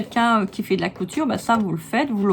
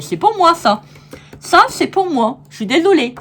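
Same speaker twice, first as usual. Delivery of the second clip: louder, higher mock-greedy claim; mock possessive; 6 semitones higher, 6 dB louder than usual. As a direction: pretend to hoard it, jokingly. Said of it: "Non, je rigole". (fausse possessivité, plus fort et plus aigu)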